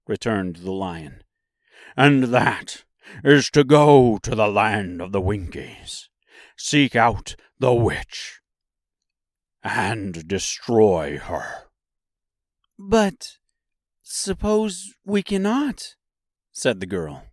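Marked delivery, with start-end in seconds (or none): 2.0-11.7 lightly voiced lion, slow and deliberate; a hint of a big beast's voice; cowardly Lion. The Lion replies. 12.8-16.0 put-on high-pitched girl's voice; little girl's worried question; doubtful Dorothy. A narrator's reading.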